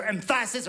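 "emphasis" wrongly stressed on the middle, em-FA-sis; stress on middle syllable. Shown as a demonstration. French speaker unsure of English stress, saying "emphasis" as em-FA-sis. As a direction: punch the FA in "emphasis". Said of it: "Even in the word emphasis".

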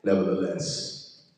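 usual speaking voice

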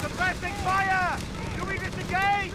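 soldier yelling under fire; urgent combat shout. Acted. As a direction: shout over the firefight.

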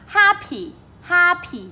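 happy with HAP high and loud, py falling; stress on first syllable. Shown as a demonstration. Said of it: "nghe rõ trọng âm một".

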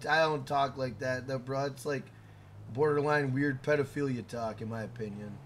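normal talking voice

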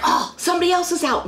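high-pitched, thrilled exclamation; retold excitement. Retelling the family hearing neighbors join in, excited high exclamation. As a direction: jump up high, acting out the discovery.

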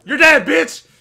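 high, loud gloating yell; taunting shout. Real, not acted. Player killing an opponent, taunting shout. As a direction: shout it at your victim.

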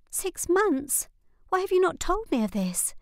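put-on high, hurt girlish voice; a younger sister stung at being left out; young sister Lizzie. A narrator's reading. Sister asks Nora why she kept it secret, high and hurt.

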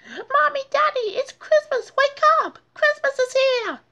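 put-on very high, loud thrilled child; excited cry. D W waking the family on Christmas. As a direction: shriek it with Christmas joy.

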